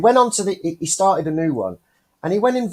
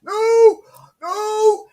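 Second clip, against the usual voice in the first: high, loud refusing cry; shouting; 7 dB louder than usual. Retold or imitated. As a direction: shout it down again. Thai guy shouting no again.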